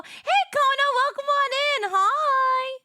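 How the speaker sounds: high, excited welcome to a viewer; chirpy high hello to the chat; bright greeting. Real, not acted. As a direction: sing out a welcome to a new viewer.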